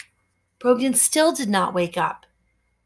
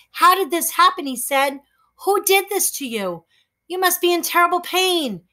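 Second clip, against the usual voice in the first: put-on higher boy voice, worried; concerned boy; 7 semitones higher than usual. Boy asking the hurt hairy man. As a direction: ask gently about the wound.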